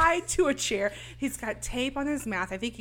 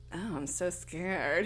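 slow, soft, lower sarcasm; sarcastic mock fear; 4 semitones lower, 5 dB quieter, much slower than usual. Killer taunting Casey after her threat, sarcastic mock fear.

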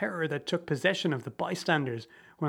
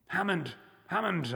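despairing cry; 3 semitones higher, 3 dB quieter than usual. A narrator's reading.

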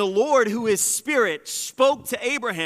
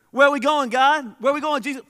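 higher, louder plea; calling out; 6 semitones higher, 6 dB louder, faster than usual. Acted. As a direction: call out to someone walking ahead. Disciple calling out.

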